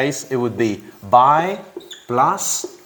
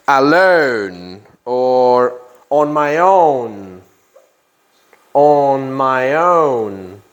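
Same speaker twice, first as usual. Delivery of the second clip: slow and clear; 3 dB louder, much slower than usual. Shown as a demonstration. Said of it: "teacher spelling out meaning slowly".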